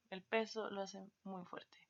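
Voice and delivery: a hushed voiceless aside; whispered aside. Acted. Teacher whispering a hint to the class.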